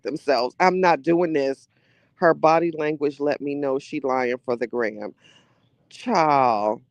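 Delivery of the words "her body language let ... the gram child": ordinary delivery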